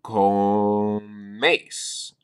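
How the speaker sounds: comes said drawn out, Spanish e and s, stress on the first syllable; model Spanish word. Shown as a demonstration. Teacher modeling comes for Spanish learners. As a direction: hold out comes clearly. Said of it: "You would say".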